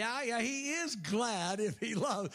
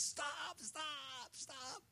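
softer, higher and gently pleading; soft stop; 7 semitones higher, 12 dB quieter, much slower than usual. Acted. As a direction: hush the striving with a soft, tender stop. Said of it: "Lord says".

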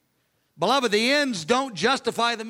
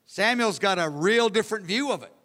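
ordinary delivery

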